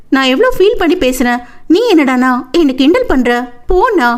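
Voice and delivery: mock-hurt whine to a brother; sulky sister. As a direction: pout and protest at being teased. Teased sister sulks at brother.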